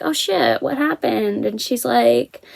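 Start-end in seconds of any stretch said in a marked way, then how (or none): 0.0-1.5 retold surprise, pitched up high; higher-pitched re-enacted reaction to a friend's news; surprised concern. Asking an upset friend in the lunch line, mild surprise.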